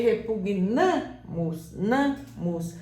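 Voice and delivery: "repugnamos" devagar, com força no NA; tônica no NA. Shown as a demonstration. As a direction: destaque e repita o NA de "repugnamos". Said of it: "a sílaba tônica não vai ser mais o pu". ("repugnamos" com tônica marcada no NA)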